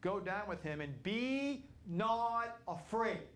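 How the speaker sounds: high, slow, solemn angel voice; lifted, slow voice of the Lord's angel; angel speaking. Retold or imitated. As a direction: draw out the angel's command slowly. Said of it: "the angel of the Lord said unto Elijah".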